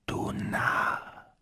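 slow, toneless inhuman whisper; distant, hollow, ghostly whisper; unearthly hollow voice. A narrator's reading. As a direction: whisper like a bodiless thing from the grave. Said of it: "die Stimme sei tief gewesen".

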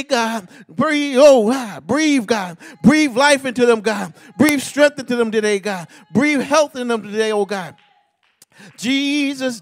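preaching prayer, rising and crying out to God; fervent prayer. Acted. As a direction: pray it out with full fervour, calling on God. Fervent closing prayer at the altar.

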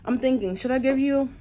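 talking while chewing gum; words round a piece of gum. Real, not acted. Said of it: "I'm chewing this gum".

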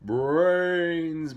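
loud, high, drawn-out zombie wail; zombie moan. A narrator's reading. Zombies approaching the pigs, loud moaning call. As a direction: moan it long like a hungry zombie. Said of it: "mumbled the zombies".

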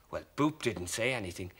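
ordinary delivery

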